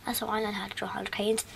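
quick whispered aside; whispering. Real, not acted. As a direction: whisper it fast under breath. Muttered aside during game.

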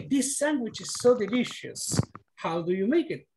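diálogo leído con tono un poco más alto; leyendo un diálogo. Acted. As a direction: lee el papel A con ánimo. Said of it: "yo voy a hacer A". (profesor leyendo el papel A del diálogo)

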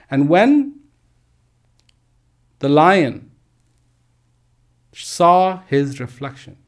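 normal talking voice

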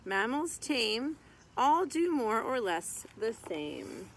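lively sing-song rhyme reading; reading to children. Acted. Reading a mammal picture book to kids, lively sing-song. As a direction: read the rhyming verse out to little kids.

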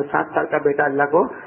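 usual speaking voice

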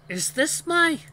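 high, loud, shocked question; disgusted disbelief. A narrator's reading. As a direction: cry out in appalled disbelief. Disgusted wolf inspects the gag.

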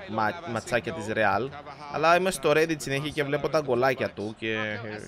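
ordinary talk, nothing marked

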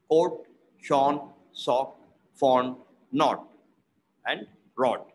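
"shone, sock, knot, rod" with one short British O; "rod" with open mouth and rounded lips. Shown as a demonstration. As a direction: keep the vowel short and rounded, no glide, as in "knot". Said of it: "we got O".

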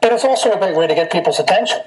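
muffled behind respirator mask; speaking through layered masks; through a face mask. Real, not acted. Seen 3: Gray half-face respirator worn under a surgical mask throughout the labelled words.

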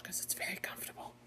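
quick whispered aside; whispering. Real, not acted. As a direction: whisper it behind the owner's back. Aside about the couch.

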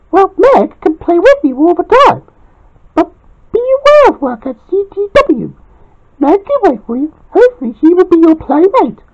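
high, bright cartoon girl advising; Lisa Simpson voice. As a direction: keep Lisa's high voice, friendly advice.

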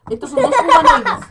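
proclamado más alto y agudo; anúncialo como un presentador; anuncio exagerado. Acted. Niños jugando con filtro de cámara. (anuncio exagerado a voz en grito)

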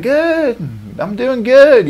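high, loud fake-polite answer; hammed-up forced cheer; mock cheerful reply.

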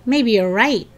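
plain reading voice

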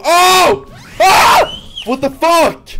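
high terrified screaming; shrieking in real panic; scared horror game streamer. Real, not acted.

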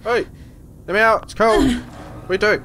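high panicked yell; panicked shouting. Real, not acted. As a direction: shout to be let out. Trapped in pod, banging.

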